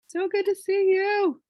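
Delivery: high, loud, drawn-out squeal of welcome; excited greeting. Real, not acted. Host greeting arriving attendees, excited high squeal. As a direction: sing out a delighted hello, very high and loud.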